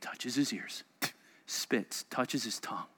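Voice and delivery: a whispered play-by-play of the healing; hushed narration. Acted. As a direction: draw them in with a quiet whisper. Acting out the healing step by step.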